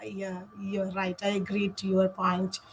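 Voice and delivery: ordinary talk, nothing marked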